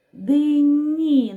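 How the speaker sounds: "Дині" stretched high and loud, sound by sound; drawn out for sounding. Shown as a demonstration. Teacher doing sound analysis with children, "Дині" drawn out. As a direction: sing out each sound of "Дині" slowly. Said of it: "Виконаємо звуковий аналіз слова".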